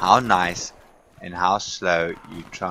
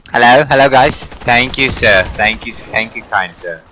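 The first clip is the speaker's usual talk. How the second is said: mock polite gentleman; 4 semitones higher, 12 dB louder than usual. Role-playing polite thanks.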